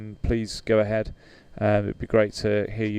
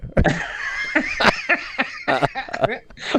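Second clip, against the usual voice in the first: a long high giggling laugh; long high giggle after his own joke. Real, not acted.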